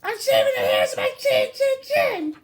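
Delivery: high pig voice shouting from inside; pig yelling back. Acted. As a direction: shriek it as a tiny scared pig. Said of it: "He yelled back".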